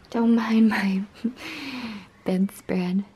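whispered aside. Real, not acted. Aside while cats shown.

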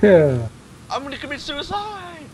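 sudden high, loud hammed-up scream; mock shriek. Friend jokingly shrieks a character line, hammed-up scream.

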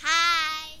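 high, drawn-out little greeting; high-pitched hi. Pretend. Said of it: "in his really high-pitched voice".

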